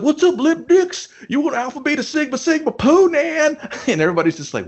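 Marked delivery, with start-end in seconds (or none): 0.0-3.6 put-on old frat guy. Frat jock greeting the nerds with a big higher bro yell. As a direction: pump it up like the loudest guy at the frat party. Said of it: "He's gonna be like".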